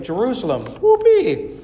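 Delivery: overjoyed little donkey; excited whoopee. A narrator's reading. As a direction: burst out with glee. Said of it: "Jacob's heart skipped a beat".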